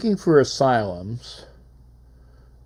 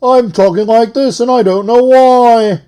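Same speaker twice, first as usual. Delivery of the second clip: loud, high-pitched TV host bellow; over-the-top shouted celebrity-show host; Robin Leach impersonation; 8 semitones higher, 11 dB louder than usual. Acted. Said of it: "Robin Leach".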